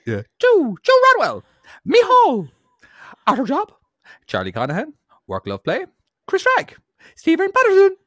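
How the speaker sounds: very high, loud cartoon squeak; squeaky roll call, breaking into laughs; Mickey Mouse voice reading names. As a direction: read each name like Mickey. Reads patron names in Mickey voice.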